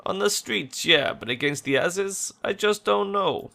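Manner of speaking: doubtful worried friend. Acted.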